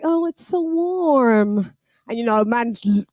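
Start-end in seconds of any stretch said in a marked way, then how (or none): 0.0-1.7 slow, drawn-out mock sigh of comfort; lingering mock coo, higher. Boyfriend in the warm bath, mocked with a slow coo. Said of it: "he's like".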